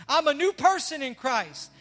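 loud, rising preacher's call to the crowd; full-force preaching. Acted. Pastor preaching forgiveness to his church, loud and fervent. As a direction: preach it out to the congregation. Preaches new creature, building.

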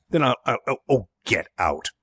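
flustered, stammering dismissal; exasperated. A narrator's reading. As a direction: run out of threats and give up. Flustered employer dismisses the butler.

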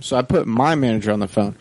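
ordinary delivery

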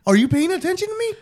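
retold exasperation. Husband asking his wife for context, comically exasperated. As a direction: act out a fed-up husband demanding sense.

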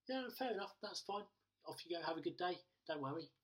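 high-pitched, breezy reassurance; acted easy forgiveness. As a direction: wave away an apology, voice lifted. Acts out forgiving the stranger.

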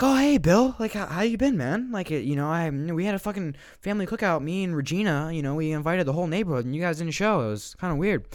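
high-pitched, over-friendly neighbor voice; hammed-up chummy greeting, loud at first, easing slightly; Martin. Pushy neighbor greets the man who avoids him, over-chummy.

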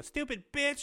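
put-on very high, small child parroting a curse; toddler copying. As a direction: repeat it like a little kid. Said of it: "the kid will walk up and look at me and go".

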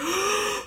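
sudden high intake of breath; real gasp. Real, not acted. Catching breath mid-sentence on the podcast, a sudden gasp. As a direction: gasp for forgotten air. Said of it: "Forgot how to breathe there for a second".

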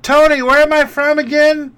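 high, loud put-on voice of the waitress; mock waitress. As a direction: squeak it out like the clueless waitress. Mimicking waitress asking Tony.

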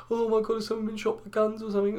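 high, breathless busybody voice; nosy neighbour gasping at an ambulance; mock gasping gossip. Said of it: "a comment that says".

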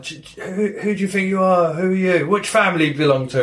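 high-pitched indignant challenge, lightly acted; mock confrontational. Retelling a stranger having a go at player, mock indignation. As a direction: imitate someone having a go. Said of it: "starts having a go at you".

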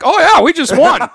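high, loud, startled realization; suddenly realizing they've won. Retold or imitated. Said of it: "then they're like".

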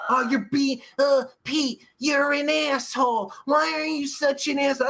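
imitated complainer. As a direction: mimic someone's mild indignant scolding. Imitates people complaining about him.

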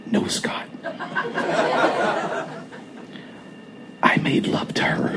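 breathy near-whisper, hushed and calm; calmly whispering to the enraged husband, almost a whisper. A narrator's reading. Whispered calm reply to jealous Scott. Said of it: "quiet voice, almost a whisper".

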